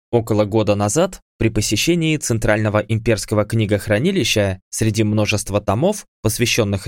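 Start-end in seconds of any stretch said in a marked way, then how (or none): none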